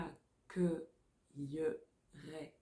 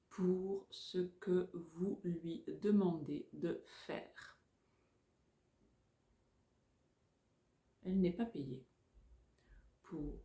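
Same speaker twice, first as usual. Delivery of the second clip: dictating. Shown as a demonstration. Dictation chunks.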